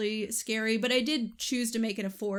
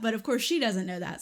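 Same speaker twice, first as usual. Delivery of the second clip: ordinary talk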